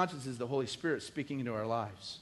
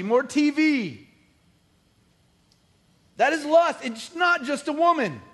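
much higher and louder, fervent; full-force preaching; 7 semitones higher, 7 dB louder than usual. Acted. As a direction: preach it hard to the crowd.

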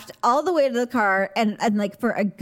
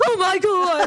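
mock distant yell; 6 semitones higher than usual. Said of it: "All the way down the hall".

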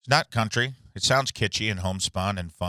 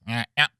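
wordless buzzy doctor mumble; fly-like mumbling; 3 semitones higher than usual. Retold or imitated. Comic shows how his doctor talks, mumbling impression. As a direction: imitate someone yammering like a fly. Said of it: "He talks like a fly".

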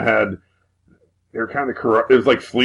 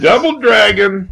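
shout; 10 semitones higher, slower than usual. Acted. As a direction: yell it like a battle cry. Joins the shouted cry.

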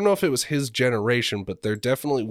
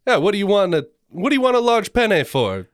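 high, loud hammed-up Italian accent; Italian grandpa impression; 9 semitones higher, 5 dB louder than usual. Cousin performing his grandpa on request, in a hammed-up Italian accent. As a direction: scold like an Italian nonno in a thick accent. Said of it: "Let's hear it".

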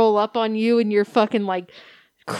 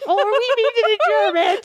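mock offended German; 11 semitones higher than usual. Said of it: "It's like".

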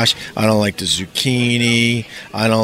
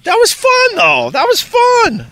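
excited; 5 dB louder than usual. Real, not acted. Singing out that was fun.

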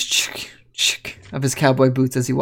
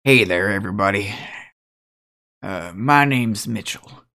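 put-on lower, easygoing older guy; laid-back cowboy; 5 semitones lower than usual. Mitchell greeting the party, lower and laid-back. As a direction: drop it down, relaxed and modest. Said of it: "and he goes".

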